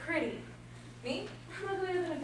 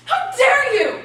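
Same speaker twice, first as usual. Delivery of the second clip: loud and much higher, indignant; outraged; 12 semitones higher, 15 dB louder, slower than usual. Acted. Helena outraged at Lysander's mocking love talk. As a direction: fling it at him, insulted.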